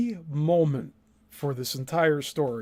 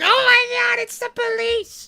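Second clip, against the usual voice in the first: shrieking very high, then dropping to a breathless whisper; mock-panic squeal that collapses into a hushed gasp; hammed-up terror at the knock on the door; Penny panicking; 6 dB louder than usual. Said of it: "And then Penny's like".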